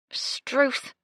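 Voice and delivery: ordinary delivery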